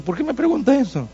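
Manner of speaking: high-pitched, soft puzzled question; puzzled waiter. Retold or imitated. Waiter questioning the pastor's question, puzzled.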